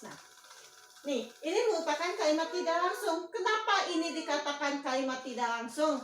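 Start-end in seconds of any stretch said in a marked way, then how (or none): none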